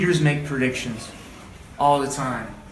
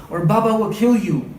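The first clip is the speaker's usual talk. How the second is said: higher, anxious warning; urgent warning; 6 semitones higher, 4 dB louder than usual. A narrator's reading. Girl warning the dolphins, higher and anxious. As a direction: warn them in a rush. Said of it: "hissed Putul".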